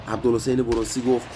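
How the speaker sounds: usual speaking voice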